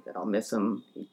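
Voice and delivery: ordinary talk, nothing marked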